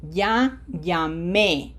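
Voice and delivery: slow "Ya llamé", weight on the final é; stress on final É. Shown as a demonstration. Teacher repeating the accented verb for pupils, stress on the last syllable of "llamé". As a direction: stretch it out and stress the end of "llamé". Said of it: "So I put emphasis there".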